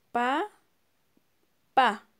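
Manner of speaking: "Pa, pa." in two clear beats with a pause between; teacher counting syllables aloud. Shown as a demonstration. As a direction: tap out each "pa". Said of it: "¿Cuántas sílabas tiene?".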